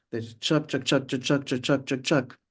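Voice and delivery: steady chugging chuck chuck like a train; train rhythm. Shown as a demonstration. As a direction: chant it like a train on the tracks. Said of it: "like a train".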